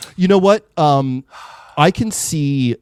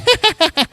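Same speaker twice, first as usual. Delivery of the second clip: witch cackle; 3 dB louder than usual. Acted. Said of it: "my amazing witch voice".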